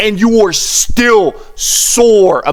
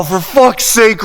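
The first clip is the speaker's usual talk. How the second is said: normal talking voice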